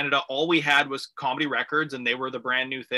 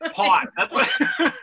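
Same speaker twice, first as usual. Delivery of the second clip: laughing; 11 semitones higher, 3 dB louder, slower than usual. Real, not acted. Host joking about guest's accent.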